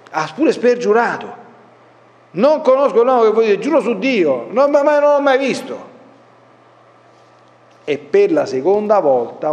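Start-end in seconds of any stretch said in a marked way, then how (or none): 2.3-5.8 Pietro che giura, voce più alta e concitata; spergiuro agitato. Acted. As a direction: giura disperato di non conoscerlo, con foga. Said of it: "cominciò a imprecare e a giurare". (Pietro davanti ai presenti giura agitato di non conoscere Gesù)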